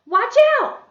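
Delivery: loud, high warning cry; exclamation. Shown as a demonstration. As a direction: call it out sharply as an urgent warning. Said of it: "An exclamation".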